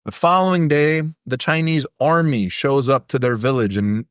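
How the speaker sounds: ordinary delivery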